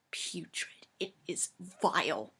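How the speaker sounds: soft, near-whispered revulsion; quiet, dramatic disgust; hushed disgust. Acted. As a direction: breathe it out in horror. Describes smell with disgust.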